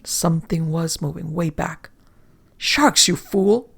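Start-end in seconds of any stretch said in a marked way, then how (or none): 2.6-3.7 high, loud contemptuous yell; scornful shout. A narrator's reading. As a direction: spit the insult at him. Captain scorns sailor.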